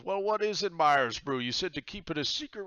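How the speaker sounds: high, curious pirate captain; pirate god voice. Acted. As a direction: lean in like an eager old sea dog.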